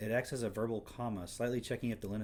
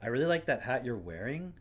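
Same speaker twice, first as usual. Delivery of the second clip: louder, "like" and "wearing" tipped up like a question; exaggerated upspeak; 2 semitones higher, 6 dB louder, faster than usual. Shown as a demonstration. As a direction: ham up the rising end on "wearing". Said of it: "It'd be more like".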